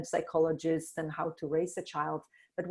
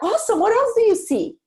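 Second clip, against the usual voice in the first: excited parent; 12 dB louder than usual. Pretend. Ideal parent answering child.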